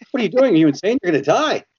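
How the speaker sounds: acting out shocked concern for a crazy friend; incredulous alarm. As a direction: retell it as baffled, scolding alarm. Retelling his shocked reaction to costume.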